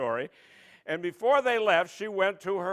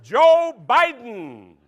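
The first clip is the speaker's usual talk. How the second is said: slow, loud name reading; slow announcer; 3 semitones higher, 9 dB louder, much slower than usual. Pretend. Host announcing the word, slow and loud. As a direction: stretch the name out.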